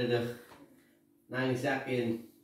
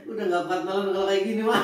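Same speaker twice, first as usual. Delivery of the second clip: laughing; 6 semitones higher, 4 dB louder than usual. Real, not acted. Laughing at crying mom.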